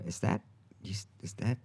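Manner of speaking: soft, halting, tentative question; mildly awkward, hushed inquiry; hesitant asking. Retold or imitated. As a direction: stammer it out gently, feeling obliged to ask. Retold hesitant question to Sam Neill.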